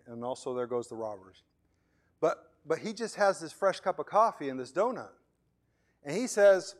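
ordinary talk

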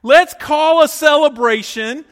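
loud, slow, jubilant call to celebrate; celebrating. Retold or imitated. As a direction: throw a party with your voice. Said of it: "And you're like".

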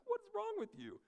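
mock exasperated question; incredulous. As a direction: squeak it out in exasperated disbelief.

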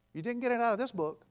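rising sermon fervor; preaching at full force. Real, not acted. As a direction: drive the point home to the congregation. Preaching climax.

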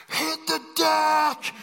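high, slow, drawn-out mock scream; panicked shout. Camp kid warning the others of gunfire, panicked shout. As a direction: shout the alarm with a long last word.

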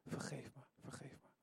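repeated plea sinking from soft to a whisper; humble prayer dying away into a whisper; prayer fading to whisper. Shown as a demonstration. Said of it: "zeg gewoon".

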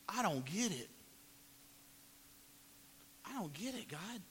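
broken, fading plea; crying out. Acted. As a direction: let it crack and fade. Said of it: "he's crying out".

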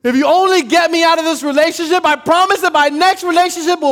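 high, loud pleading; desperate prayer. Retold or imitated. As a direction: bargain with God in a panic.

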